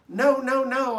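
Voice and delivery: high, loud frantic hamster squeaks; squeaked panic. A narrator's reading. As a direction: squeal it in dread of the dark. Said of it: "I squeaked".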